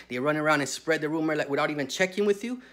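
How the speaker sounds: ordinary delivery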